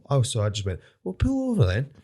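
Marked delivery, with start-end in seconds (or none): 1.0-1.9 high-pitched daring retort; acted challenge. Driver calling out the man following him, mock confrontational. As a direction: throw down the dare, voice jumping up.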